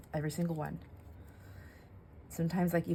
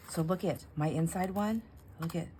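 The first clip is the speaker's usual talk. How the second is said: usual speaking voice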